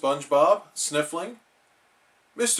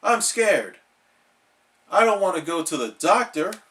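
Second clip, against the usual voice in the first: higher, louder frightened plea; scared; 5 semitones higher, 4 dB louder than usual. Acted. SpongeBob scared of going to the doctor. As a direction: whimper that you won't go. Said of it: "cried SpongeBob".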